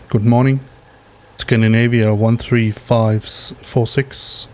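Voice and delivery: normal talking voice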